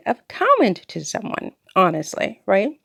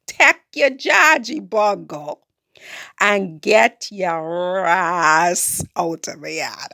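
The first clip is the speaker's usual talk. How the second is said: slower, drawn-out Creole telling-off; slower than usual. Retold or imitated. Repeating her order to Raphael, slower Caribbean Creole. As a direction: stretch each word in Caribbean Creole. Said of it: "in Creole".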